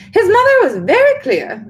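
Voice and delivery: high, loud insistence; insistent witch. A narrator's reading. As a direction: dig in her heels.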